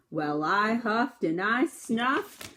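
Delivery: drawn-out huff and snuff; slow huffing. Acted. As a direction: stretch it out slowly. Wolf huffing before sneeze.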